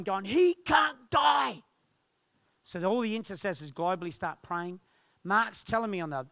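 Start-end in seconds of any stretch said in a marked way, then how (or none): 0.3-1.6 loud, high, stretched-out scream; imitated anguished shout; yelling. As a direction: yell it in desperate protest, every word drawn out. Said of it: "Jem's yelling".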